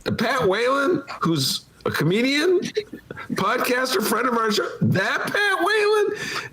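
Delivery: high disbelieving retold question; incredulous. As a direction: squeak it out in disbelief. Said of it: "And I go".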